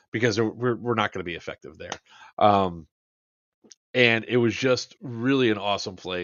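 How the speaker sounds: usual speaking voice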